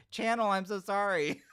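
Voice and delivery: high, loud embarrassed apology; mortified apology. Real, not acted. Host apologizing to a viewer over a name, high mortified outburst. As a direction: blurt out an embarrassed sorry.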